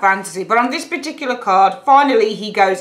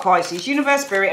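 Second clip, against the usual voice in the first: usual speaking voice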